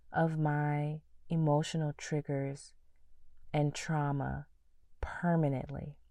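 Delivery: hushed earnest prayer to God. Shown as a demonstration. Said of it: "it doesn't really sound like I asked a question".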